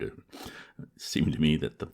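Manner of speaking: ordinary delivery, nothing marked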